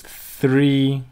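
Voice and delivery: three stretched out slowly; drawn-out three. Shown as a demonstration. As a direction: hold three long, then laugh at it. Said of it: "I'm afraid it goes too long".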